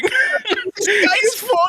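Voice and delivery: high, slow laughing cry; mock cartoon wail; Chicken Little voice. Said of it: "I'd run around the town going".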